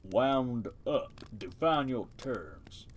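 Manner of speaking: a lightly deepened slow turtle voice from the mud; deep voice. Acted. Turtle in the mud lecturing the mice, lightly deepened voice. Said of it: "give it a deep voice".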